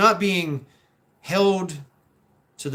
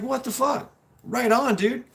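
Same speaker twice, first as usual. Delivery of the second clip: higher-pitched acted amazement; amazed approval; 9 semitones higher than usual. Onlooker reacting to a worker's success, mock amazed. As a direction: cheer him on, impressed.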